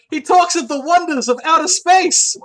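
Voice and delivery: loud, high, rousing mock-sermon; grand proclamation. Droid preaching to the others, loud proclamation. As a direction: proclaim it to a crowd of followers.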